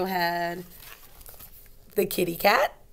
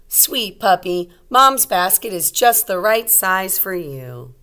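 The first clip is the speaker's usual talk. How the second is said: louder, bright storybook voice; reading to children; 7 dB louder, slower than usual. A narrator's reading. Teacher reading a picture book aloud to young kids.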